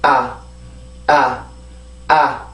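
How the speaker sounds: 아 said soft and low, throat relaxed; soft unstressed a. Shown as a demonstration. Teacher showing a gentle unstressed vowel. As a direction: let 아 come out gently with no push. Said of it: "부드럽게".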